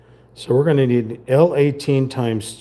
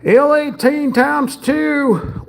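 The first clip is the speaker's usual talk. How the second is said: much higher, slow cartoonish voice; silly put-on voice; 12 semitones higher, 3 dB louder, much slower than usual. Reading the part label to viewers in a goofy high voice.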